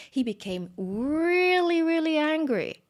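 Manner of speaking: high, loud, slow stretched really; emphatic anger. A narrator's reading. Storyteller stressing baby Krishna's rage, loud and stretched. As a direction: swell the words like rising rage.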